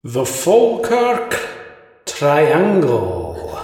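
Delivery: slow, high, loud spooky reveal; dramatic announcer. Pretend. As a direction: announce it like a horror trailer. Dramatic episode title reveal.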